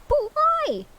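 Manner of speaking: "why" shot up high and loud; high indignant cry. Shown as a demonstration. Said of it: "Oh, shit, fuck".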